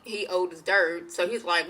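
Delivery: usual speaking voice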